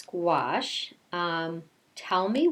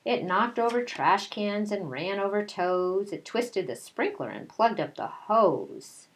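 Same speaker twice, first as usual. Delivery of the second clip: storybook read-aloud voice; reading to children. A narrator's reading. Teacher reading a picture book to her class, storytelling voice.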